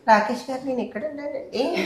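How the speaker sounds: high-pitched mimicry of the actress; imitated small voice. Director mimicking his actress calling for him, in a small high voice. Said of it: "చిన్న voice తీసుకొని".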